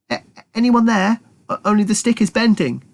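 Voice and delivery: high, halting voice; small stammering rat. Acted. Trapped rat calling for help, small and stammering. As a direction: stammer nervously. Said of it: "said the voice".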